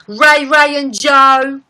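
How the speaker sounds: loud, high, drawn-out London shout-out. Retold or imitated. Streamer imitating the singer's Grammy speech, loud London accent. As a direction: call out the names slowly in London accent. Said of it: "her Grammy speech where she was like".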